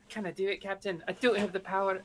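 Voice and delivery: lightly put-on Scottish accent, quiet; a hint of Scottish brogue. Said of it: "my terrible Scottish accent".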